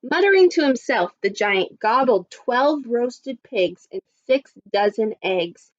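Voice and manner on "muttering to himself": ordinary delivery, nothing marked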